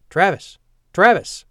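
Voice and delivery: urgent shout of a name; calling out. Acted. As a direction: call loudly to wake him. Mickey wakes him, calling out.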